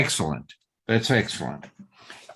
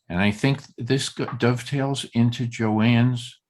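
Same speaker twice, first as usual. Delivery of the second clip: usual speaking voice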